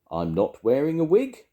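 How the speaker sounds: high, loud indignant retort; sharp. A narrator's reading. Grandma mishears pig as wig, sharp retort. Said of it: "she said sharply".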